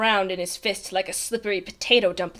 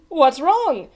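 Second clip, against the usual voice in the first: raised, carefree question; smiling unconcern; 5 semitones higher, 5 dB louder than usual. A narrator's reading. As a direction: ask with an untroubled smile. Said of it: "smiled the captain".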